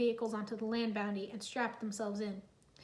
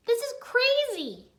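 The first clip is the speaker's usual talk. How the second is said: high, loud frustrated outburst; bursting out; 12 semitones higher, 7 dB louder, slower than usual. Acted. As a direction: blurt it out, fed up. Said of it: "Jay burst out".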